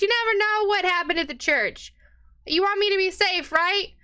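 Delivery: butter up his wife to get away; sweet-talking husband. Acted.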